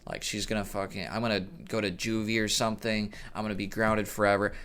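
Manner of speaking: quiet mock panic inside your own head; panicked inner thoughts. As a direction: mutter the worries low under your breath. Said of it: "I was like".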